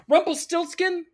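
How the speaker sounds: normal talking voice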